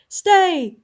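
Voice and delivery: loud, booming halt; thundered command. Acted. King halting the dwarf column, thundered command. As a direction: thunder it at the soldiers. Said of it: "thundered Trinian".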